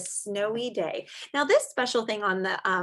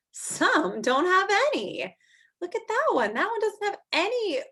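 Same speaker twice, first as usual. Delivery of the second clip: higher, eager look-at-that; excited pointing; 9 semitones higher than usual. Acted. Teacher showing kids a snowflake picture, higher and eager. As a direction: point it out like a discovery.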